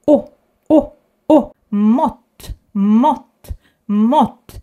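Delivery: mott with a short round O; mott, quick rounded O; short Swedish O. Shown as a demonstration. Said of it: "the short one is just the same".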